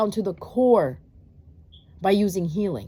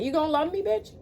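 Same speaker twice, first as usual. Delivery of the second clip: gangster attitude; 6 semitones higher than usual. Pretend. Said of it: "You gotta be gangster like that".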